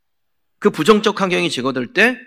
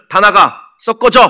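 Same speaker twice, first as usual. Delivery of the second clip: loud, commanding shout to drive people out; acted shout; 5 semitones higher, 8 dB louder, slower than usual. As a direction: yell them out of the room. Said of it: "막 소리를 지르면서".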